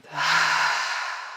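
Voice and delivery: long, airy exhale fading away; drawn-out open-mouthed sigh; audible sigh out. Shown as a demonstration. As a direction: breathe it all out with a releasing sigh. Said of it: "sighing it out".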